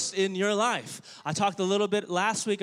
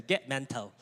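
low, soft, badly faked British accent; terrible British accent; 6 semitones lower, 5 dB quieter than usual. Shown as a demonstration. Preacher prompting the congregation to repeat, hammed British accent. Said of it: "I actually have a terrible British accent".

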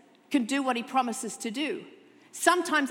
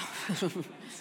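soft chuckle; 5 semitones lower, 6 dB quieter than usual. Real, not acted. Laughs at own joke.

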